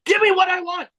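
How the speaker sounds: high-pitched meme imitation; pitched-way-up mock demand; Batista. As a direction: mimic a wrestler's demand in a strained high voice. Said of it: "Batista being like".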